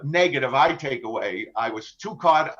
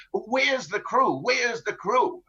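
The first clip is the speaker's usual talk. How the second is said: higher, slow, drawn-out question to the captain; pirate demanding; 6 semitones higher, much slower than usual. Retold or imitated. Captain imitating pirates demanding the crew's whereabouts, high and slow. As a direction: lean into each word like a demanding captor. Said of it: "that's what they constantly queried me".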